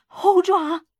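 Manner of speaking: wild high cry; loud, high, violent outburst; frenzied shout. A narrator's reading. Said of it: "他狂暴的叫嚷".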